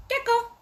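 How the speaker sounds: high chirped "gecko" like a lizard squeak; chirpy gecko call. Shown as a demonstration. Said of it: "it sounds like gecko".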